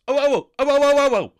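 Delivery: loud, high burst of oh's; high shouted excitement; excited yelling. Retold or imitated. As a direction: yell like the idea just hit you. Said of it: "gets an exciting idea and yells".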